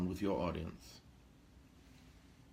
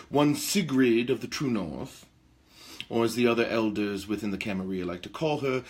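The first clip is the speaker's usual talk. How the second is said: ordinary talk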